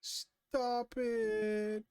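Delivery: high-pitched playful squeal; high protest. Real, not acted. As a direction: squeal it in mock alarm.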